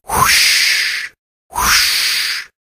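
breathy "whoosh" like surf; drawn-out whooshing wave noise; crashing waves. Acted. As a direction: imitate waves rolling in, unvoiced hush. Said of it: "sound of crashing waves".

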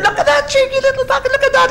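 very high, screechy monkey jabber; hysterical monkeys. Acted. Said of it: "these monkeys by this time are having hysterics".

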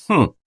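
short musing "hm"; a quick, thoughtful hum through closed lips; a trainee's brief pondering hum. A narrator's reading. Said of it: "хмыкнул стажёр".

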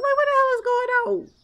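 high, shrill what the hell; shocked. Retold or imitated. Streamer shocked by a customer's open shirt, high and squealing. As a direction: squeal in disbelief. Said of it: "I'm like".

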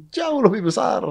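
"jauh lebih besar" swooping up high; comically overstressed "jauh lebih besar"; emphatic exaggeration. Real, not acted. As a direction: stretch "jauh" high to drive it home. Repeats the exaggerated point for emphasis.

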